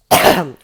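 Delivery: loud rough throat clear. Real, not acted. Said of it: "I'm coughing so much".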